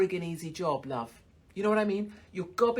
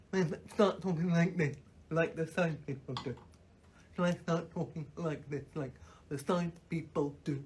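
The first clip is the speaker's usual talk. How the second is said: mock deaf voice; 3 semitones lower than usual. Shown as a demonstration. Comedian asking the audience whether to do the voice, mock deaf voice. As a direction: lower imitation of a deaf person's speech. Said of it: "shall I do my death voice".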